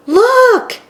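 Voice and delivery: high, loud cry of discovery; little mouse yelling to her brother from a bird's back; excited yell. A narrator's reading. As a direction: shout it out as you spot something far off. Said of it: "yelled Lila".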